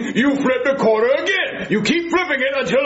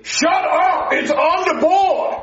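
angry shout; 7 semitones higher, slower than usual. Acted. Host snaps at the cop.

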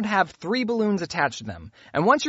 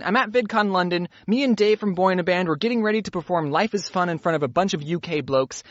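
ordinary delivery

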